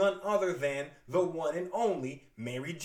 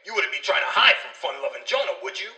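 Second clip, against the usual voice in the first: comedian voice; 2 semitones higher, 12 dB louder than usual. Acted. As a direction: ham it up like a stage comic.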